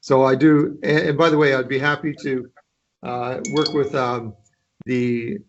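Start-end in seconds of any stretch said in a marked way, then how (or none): none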